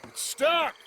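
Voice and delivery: strained call. Acted. Arthur calling out while fighting a stuck line, loud and strained. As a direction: yell it out while heaving at something stuck. Straining to free snagged boat.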